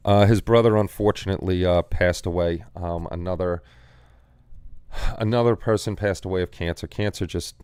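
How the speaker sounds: ordinary talk, nothing marked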